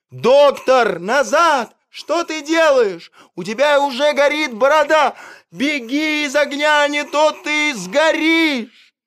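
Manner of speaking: high, loud pleading calls; frantic calling. A narrator's reading. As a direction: beg him to come back out of the fire. Animals call doctor from fire.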